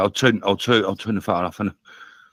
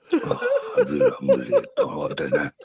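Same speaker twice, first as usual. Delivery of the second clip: embarrassed laugh through words; laughing sheepishly. Real, not acted. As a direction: giggle while apologising. Laughing at his own swearing.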